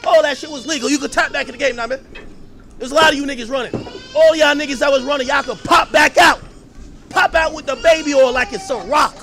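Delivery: high, loud, repeated hollering; hyped celebration. Real, not acted. Telling the crew they can pop back out, loud hype. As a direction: shout it like a victory announcement.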